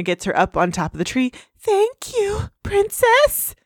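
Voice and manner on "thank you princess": Fluttershy voice. Acted. Voicing Fluttershy.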